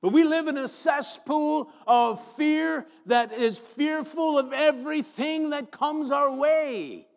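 high, loud, slow preaching; full-force preaching. Acted. Pastor rousing his church against fear, loud slow preaching.

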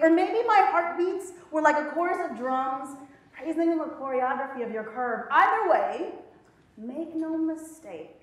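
sensual spoken-word reading, drawn out in places; slow, deliberate spoken-word delivery; poem recitation. Acted. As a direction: perform it like a love poem on stage.

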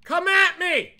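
high, loud dare; screamed challenge. Pretend. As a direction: dare the ghost to attack, yelling. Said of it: "Open the door and scream".

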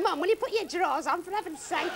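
put-on high-pitched child pleading with mum; complaining kids. Kids begging singing mother to stop, high complaint. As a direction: pitch it up as a fed-up kid. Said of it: "And the kids are going".

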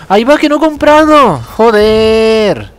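high, loud shocked outburst; sudden dismay. Real, not acted. Gamer realizes he forgot the shop, shocked cry. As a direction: blurt out in alarm.

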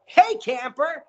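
high, pushed host yell; yelled host greeting. Shown as a demonstration. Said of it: "you gotta be like".

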